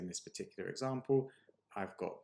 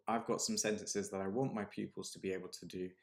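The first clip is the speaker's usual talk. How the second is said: normal talking voice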